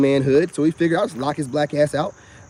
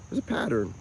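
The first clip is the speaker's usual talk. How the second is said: muttered to self; 5 semitones lower, 11 dB quieter than usual. Retold or imitated. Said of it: "I'm like".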